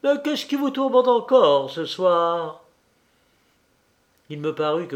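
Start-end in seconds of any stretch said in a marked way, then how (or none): 0.0-2.6 jovial. A narrator's reading. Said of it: "prenant mon air le plus jovial".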